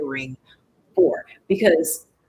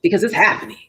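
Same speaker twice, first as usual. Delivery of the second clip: mock confident claim; 5 dB louder, faster than usual. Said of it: "No, it's not".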